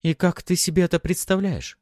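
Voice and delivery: high, wry female voice; wry smirk. A narrator's reading. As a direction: smirk through the question. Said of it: "криво усмехнулась капитан".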